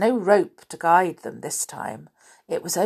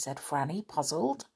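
usual speaking voice

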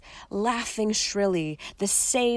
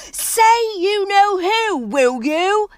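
loud, much higher, slow angry demand; 13 semitones higher, 8 dB louder, slower than usual. Acted. Ron snapping at Harry for saying the name. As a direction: cut in angrily, loud. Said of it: "interjected Ron angrily".

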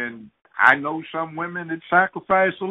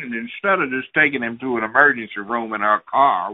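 usual speaking voice

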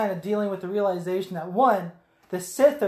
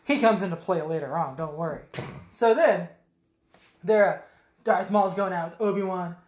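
usual speaking voice

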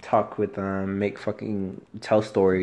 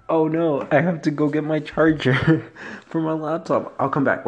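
higher, louder oh-no rush; sudden alarm; 8 semitones higher, 6 dB louder than usual. Real, not acted. Host remembers her laptop charger mid-recording, higher and louder in a flustered rush. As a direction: blurt it out like you just remembered something urgent.

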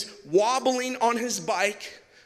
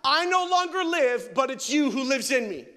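heartfelt prayer; 3 semitones higher, 3 dB louder than usual. Acted. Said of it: "in this place of saying".

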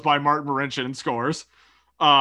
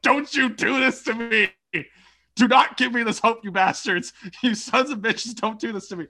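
desperate pleading rant; 7 semitones higher than usual. Retold or imitated. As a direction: rant at the team like they're toying with you. Yelling at Leafs rallying late.

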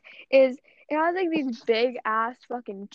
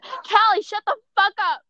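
high, loud yell of the mom; imitating mom snapping at the top of her lungs; 9 semitones higher, 5 dB louder than usual. Mom yelling at Callie for the noise, loud and high. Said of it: "she's low-key like".

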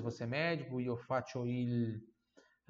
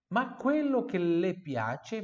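"quello" lifted higher, louder and slow; emphatic model; 6 semitones higher, 5 dB louder, slower than usual. Shown as a demonstration. Teacher highlighting the phrase for students, emphatic. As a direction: lean hard on "quello". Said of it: "mas aquilo que ela gosta".

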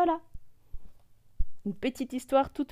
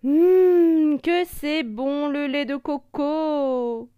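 contented murmur; 5 semitones higher, 7 dB louder, much slower than usual. Acted. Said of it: "il murmure, les yeux fermés".

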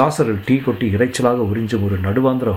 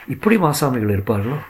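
usual speaking voice

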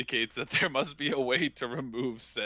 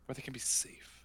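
eerie unvoiced whisper, "Where they can be safe"; 2 semitones higher, 12 dB quieter than usual. Sinister echo of the prison promise.

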